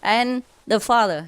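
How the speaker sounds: normal talking voice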